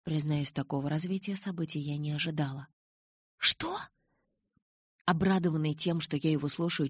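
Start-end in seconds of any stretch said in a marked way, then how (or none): 3.4-3.8 surprised. A narrator's reading. Surprise at Charlie's news.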